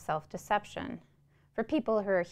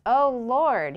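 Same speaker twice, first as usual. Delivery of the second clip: louder, higher cry to heaven; loud prayer; 6 semitones higher, 10 dB louder than usual. Acted. Mister Shiflett crying out to God after the boy leaves, loud prayer. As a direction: call out to the Lord in despair. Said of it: "he prayed".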